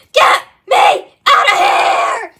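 slow, loud, high yell; desperate shout. Acted. Puppy's last words before the cliff, a slow loud shout. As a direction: drag out the plea to escape.

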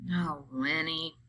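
slow, soft and gentle; tender. Acted. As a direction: soothe him softly. George softens, consoling Lenny.

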